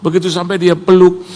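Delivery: ordinary talk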